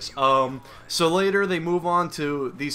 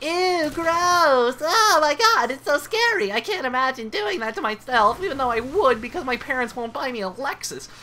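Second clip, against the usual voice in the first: put-on very high, squealing spoiled-girl voice; mock squeamish rich girls in falsetto; mock little girls; 12 semitones higher, 4 dB louder than usual. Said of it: "These fucking little girls are like".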